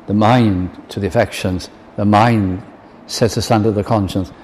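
ordinary talk, nothing marked

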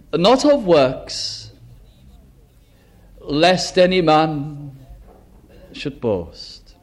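solemn scripture reading. Acted.

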